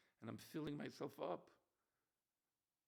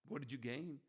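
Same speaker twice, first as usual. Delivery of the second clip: ordinary talk, nothing marked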